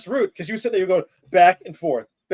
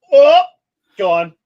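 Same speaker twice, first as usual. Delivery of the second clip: mock wipeout; 10 dB louder than usual. Joking about taking double dose.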